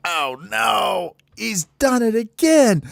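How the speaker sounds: high, drawn-out cry of dismay; squealed alarm. Acted. Birds catch another fart from baby bird, high-pitched alarm. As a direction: squeal the warning to everyone.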